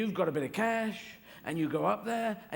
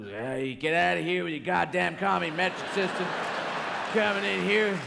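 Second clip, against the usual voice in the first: angry American; 2 semitones higher, 4 dB louder than usual. Retold or imitated. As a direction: run them out of town. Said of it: "you seem to go".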